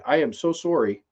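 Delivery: ordinary delivery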